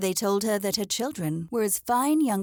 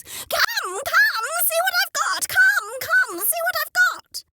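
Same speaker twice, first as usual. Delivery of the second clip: very high, loud hen cackle-call; 7 dB louder, slower than usual. Acted. Henrietta calling the whole farmyard to her nest, very high and loud. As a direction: cry out at the top of your lungs. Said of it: "calling out at the top of her lungs".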